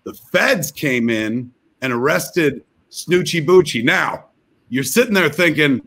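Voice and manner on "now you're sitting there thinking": ordinary talk, nothing marked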